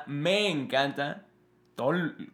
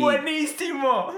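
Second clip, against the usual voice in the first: excited; 13 semitones higher, 5 dB louder, slower than usual. Real, not acted. Drawn-out exclamation.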